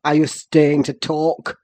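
low, loud angry demand; savage impatience. A narrator's reading. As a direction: hurry him on fiercely in a deep voice. Savage impatience with the young man.

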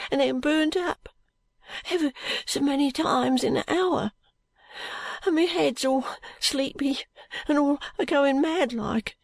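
put-on high, halting voice of a sick boy; feverish, suffering boy. A narrator's reading. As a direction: complain slowly and high, phrase by phrase, in pain. Feverish boy describes his illness.

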